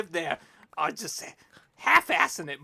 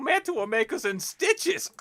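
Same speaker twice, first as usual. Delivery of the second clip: ordinary talk, nothing marked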